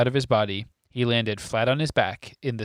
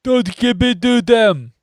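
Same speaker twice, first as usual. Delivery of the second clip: high, loud, slow roar; 12 semitones higher, 11 dB louder, slower than usual. Acted. Neville roaring at Harry not to hand over the prophecy. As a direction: roar the warning with everything. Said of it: "roared Neville".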